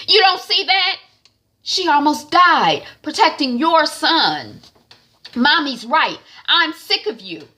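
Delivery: angry yelling. Retold or imitated. Son yelling back at mother.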